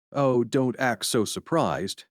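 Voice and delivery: usual speaking voice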